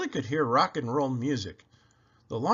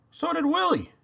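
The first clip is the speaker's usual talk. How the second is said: high squeal; 14 semitones higher than usual. A narrator's reading. Willie squealing.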